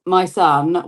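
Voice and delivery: normal talking voice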